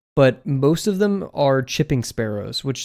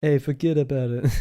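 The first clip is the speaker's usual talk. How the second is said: put-on light New York wiseguy accent; playful New York accent; mock New York accent. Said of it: "We don't talk like that".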